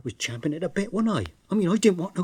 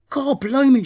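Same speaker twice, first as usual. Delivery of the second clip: loud, high startled blimey; Ern's loud, high gasp of alarm; shocked exclamation; 8 semitones higher, 9 dB louder than usual. Acted. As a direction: blurt it out in alarm. Ern startled by her tale.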